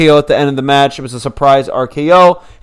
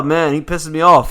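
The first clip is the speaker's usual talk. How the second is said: ordinary talk, nothing marked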